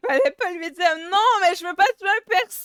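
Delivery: high, loud wailing complaint; loud distressed outburst; upset protest. Real, not acted. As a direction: protest loudly at the game's outcome. Upset protest after killing scene.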